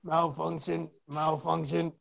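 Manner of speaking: quiet, glitchy robot chant; malfunctioning robot. Pretend. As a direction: sound like a broken machine. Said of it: "someone's malfunctioning".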